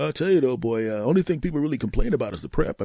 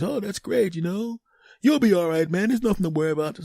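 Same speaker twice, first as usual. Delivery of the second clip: lightly voiced, higher-pitched buddy; reassuring friend; 9 semitones higher than usual. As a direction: brush off the worry cheerfully. Acted listener reassuring him.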